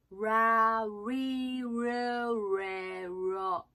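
ラリルレロ very slowly as rounded English ra-ri-ru-re-ro; English R sounds. Shown as a demonstration. Coach demonstrating R to learners, very slow English-R ラリルレロ. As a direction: stretch ラリルレロ, tongue touching nothing on each R. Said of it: "Rでラリルレロ言ったらどうなるの".

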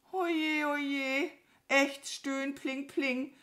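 high, pitiful bird moan; high-pitched woozy lament; moaning crow. A narrator's reading. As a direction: whimper like a dazed crow. Fallen crow moans.

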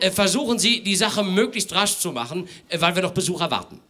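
high, hurried, hemming gentleman; a busy host rushing things along; fussy host. Acted. Herr Lemm voiced.

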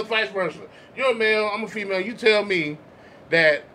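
usual speaking voice

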